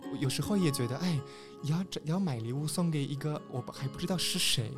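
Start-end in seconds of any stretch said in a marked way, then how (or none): none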